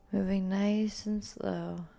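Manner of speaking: ordinary talk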